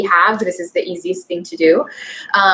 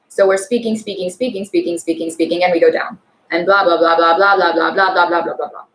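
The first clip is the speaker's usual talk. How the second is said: "speaking, speaking, blah, blah" on one low note; Russian monotone; 5 semitones lower than usual. Shown as a demonstration. Coach showing Russian pitch range, low monotone "blah, blah". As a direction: drone "blah, blah, blah" evenly, sinking at the end. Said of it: "more monotone situation, and we, uh, go down".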